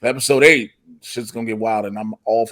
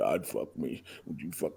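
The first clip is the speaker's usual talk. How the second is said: very soft, low, creepy killer murmur; Silence of the Lambs creep voice; 9 semitones lower, 18 dB quieter than usual. Retold or imitated. Joking to his co-host, a hushed, low Silence of the Lambs creep. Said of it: "I don't know why I went Silence of the Lambs".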